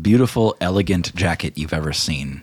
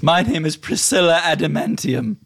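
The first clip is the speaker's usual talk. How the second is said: higher-pitched hostess introducing herself; Priscilla Adamantium; 9 semitones higher than usual. Acted. She holds her hand down to Snack, introducing herself formally. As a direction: present yourself in a raised character voice. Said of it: "she says".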